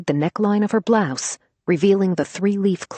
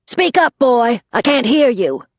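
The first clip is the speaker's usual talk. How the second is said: barked order; 8 semitones higher, 7 dB louder than usual. Acted. Grandma demands louder apology.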